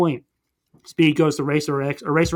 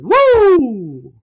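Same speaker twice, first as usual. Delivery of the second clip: much higher, louder victory whoop; triumphant whoop; 13 semitones higher, 7 dB louder, much slower than usual. Real, not acted. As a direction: cap off the story with a cheer. Recap finished with a flourish.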